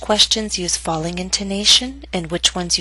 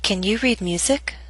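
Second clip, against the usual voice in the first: "Can you read music?" rising on "music"; rising intonation. Shown as a demonstration. Teacher models practice question, rising intonation. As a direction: lift the pitch at "music". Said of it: "I'll read it to provide a model".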